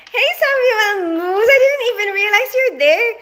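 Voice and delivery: high, squealing hello with a laugh; delighted to spot him in the chat; surprised laughing greeting. Real, not acted. Greeting a viewer she just noticed, high and laughing.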